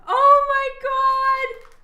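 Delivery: long, high, loud cry of real surprise; delighted shock. Real, not acted. Artist finds a hidden gift in her drawer, delighted shock. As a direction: draw it out high and loud, thrilled.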